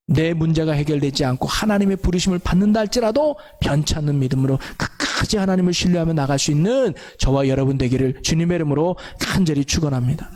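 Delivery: ordinary delivery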